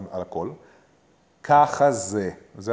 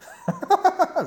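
high laugh burst; 5 dB louder than usual. Real, not acted. Forced yoga laugh.

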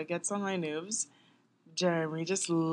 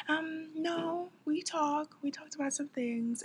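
higher-pitched evasive answer; 9 semitones higher, 3 dB quieter, slower than usual. Retold or imitated. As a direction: mimic someone dodging the question, voice lifted. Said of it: "the way that she was saying it".

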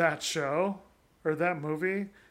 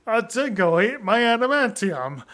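mock Australian accent; 3 semitones higher, 6 dB louder than usual. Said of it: "having his accent be just so extreme".

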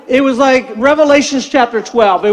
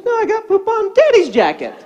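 put-on high little-girl correction; granddaughter's voice; 8 semitones higher than usual. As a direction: imitate a small child correcting Grandpa. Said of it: "And she goes".

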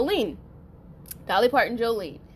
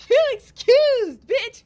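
retold yell; 14 semitones higher, 7 dB louder, slower than usual. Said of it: "That's what I said".

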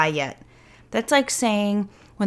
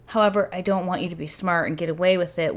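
ordinary talk, nothing marked

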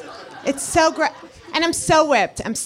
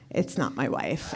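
hushed; 9 semitones lower, 9 dB quieter than usual. Retold or imitated. Punchline, dropped low and quiet.

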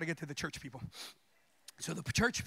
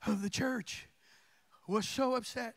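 usual speaking voice

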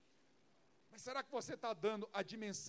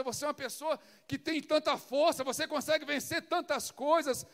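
louder, higher, rising pep talk to the wife; fervent encouragement; 4 semitones higher, 9 dB louder than usual. Retold or imitated. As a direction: lift her up, insisting she can do it. Husband encouraging his crying wife. (incentivo caloroso e enfático à esposa)

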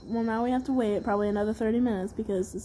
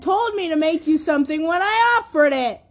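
scolding; 5 semitones higher, 6 dB louder than usual. Real, not acted. Scolding the hungry friend.

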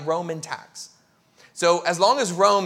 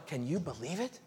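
scandalized gossip; 2 semitones higher than usual. Retold or imitated. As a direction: gossip in disbelief behind his back. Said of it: "religious people are outside going".

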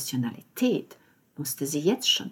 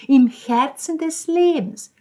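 „im Herzen des Lebens“ mimicked higher, louder, slower; imitating the landlady; 8 semitones higher, 8 dB louder, slower than usual. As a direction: quote „im Herzen des Lebens“ in her gushing voice, slowly. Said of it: "Genau so hat sie es gesagt".

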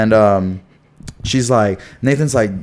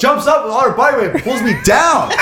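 excited, high-pitched blow-by-blow; worked-up storytelling; 10 semitones higher than usual. Real, not acted. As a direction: tell it high and incredulous, stretching the key words. Animated retelling of the attack.

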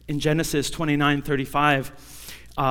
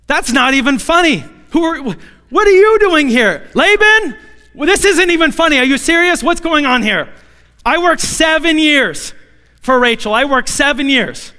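much higher and louder, an indignant yell; outraged shout; 9 semitones higher, 7 dB louder than usual. Acted. Jacob discovers Leah, furious.

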